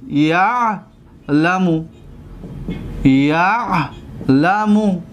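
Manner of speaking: drawn-out یعلمو, long pause between syllables; very slow model pronunciation of یعلمو. Shown as a demonstration. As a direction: stretch یعلمو right out, breaking after "ya'". Teacher models the word slowly.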